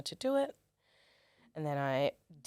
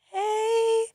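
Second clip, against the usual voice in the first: high awkward hello; 7 dB louder, much slower than usual. Retold or imitated. Awkward greeting at model go-see.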